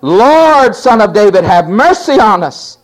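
high, loud shouted cry; shouting. Acted. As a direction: call out over the crowd. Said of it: "they started shouting".

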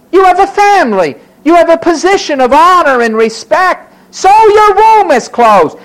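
high, loud, insistent counting of blessings; bracing rebuke. Acted. Preacher reminding Hannah of what she has, bracing rebuke. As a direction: press each point on her forcefully.

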